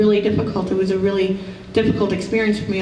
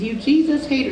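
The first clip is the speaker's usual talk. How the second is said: accusing, pitched higher in a retold yell; mock yell; 6 semitones higher than usual. Angry woman at an event accusing the psychic: imitated yell. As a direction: throw the accusation like an angry stranger. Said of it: "And she's yelling".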